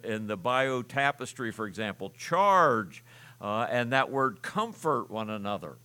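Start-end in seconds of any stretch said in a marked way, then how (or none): 2.1-3.0 a lightly voiced general yelling "charge"; mock battle charge, a bit louder and higher; general's battle cry. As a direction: call "charge" like a general to his troops. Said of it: "like a general would say".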